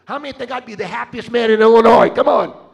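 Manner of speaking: loud, high rallying cry; full-force preaching. Real, not acted. Preacher urging the crowd to give, loud rallying cry. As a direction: whip up the crowd.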